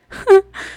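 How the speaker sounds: a quick high burst of laughter; bright giggle. Real, not acted. Vlogger giggling before her funny story. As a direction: giggle at the memory.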